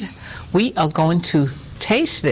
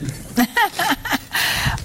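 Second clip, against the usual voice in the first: high laugh; 11 semitones higher, 3 dB quieter than usual. Real, not acted. Laughs at slicing banter.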